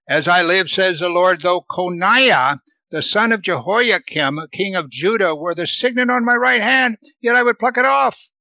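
preacher reading scripture aloud. Acted. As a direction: read the passage out to the congregation. Reads God's words aloud.

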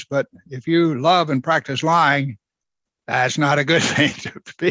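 normal talking voice

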